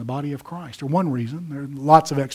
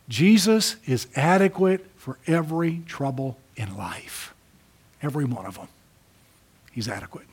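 whisper. Real, not acted. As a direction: draw them in with a near whisper. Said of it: "hushed climax of sermon".

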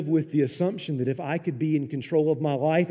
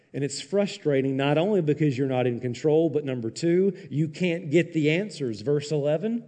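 ordinary talk, nothing marked

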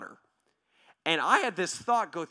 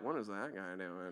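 puzzled passersby; 8 semitones lower, 11 dB quieter than usual. Retold or imitated. People driving by are like.

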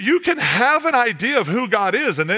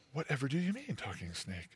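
naive Eve; 4 semitones lower, 16 dB quieter than usual. Acted. Eve answering the snake.

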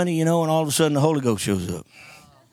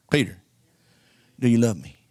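solemn Jesus; 7 semitones lower than usual. Retold or imitated. Jesus pressing Peter.

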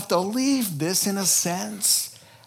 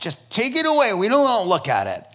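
loud, higher, disgusted dismissal; disgusted crowd; 5 semitones higher, 5 dB louder, faster than usual. Acted. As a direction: shove it away in disgust. Crowd rejecting the carcass.

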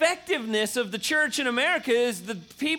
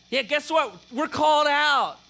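put-on louder, firm reply to a teen; firm parent; 4 semitones higher, 4 dB louder than usual. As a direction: shut down the complaint with a firm parent voice. Parent answering a teen's complaint.

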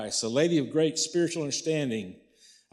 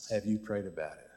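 lower, softer, sober question; quiet repeat; 5 semitones lower, 5 dB quieter, faster than usual. Real, not acted. Pastor asking the congregation about their struggles, quietly. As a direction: bring it down and let the question settle.

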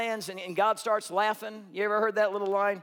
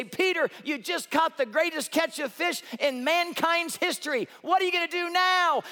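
excited reporter; 8 semitones higher than usual. Pretend. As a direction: sell it like a game-show host. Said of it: "a camera crew to come running out".

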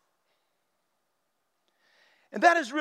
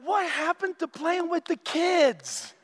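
exasperated protest; 5 semitones higher than usual. Retold or imitated. Churchgoers begging Jesus to change the subject, rising exasperation. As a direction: whine to be taken back to the fun. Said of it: "we're like".

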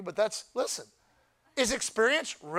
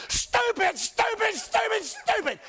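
mock scolding yell; 11 semitones higher, slower than usual. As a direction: yell stupid at a friend who skips church. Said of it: "shake them violently and say".

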